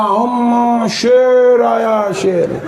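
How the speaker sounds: put-on high, slow drawn-out child cry; hammed-up child alarm. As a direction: stretch it out like a scared kid. Child announcing father's arrival. ("अम्मा, शेर आया" खिंचा हुआ, बच्चे की डरी आवाज़)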